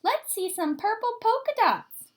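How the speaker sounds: put-on high teasing child's shout; mock playground yell; kid yelling. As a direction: schoolkid taunting Camila. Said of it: "One yelled out".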